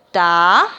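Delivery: "Ta" stretched alone, loud and low; syllable dictation. Shown as a demonstration. Teacher filling in word squares, "Ta" as a lone stretched syllable. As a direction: give "Ta" as a separate dictated syllable. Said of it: "una sola letra por cuadro".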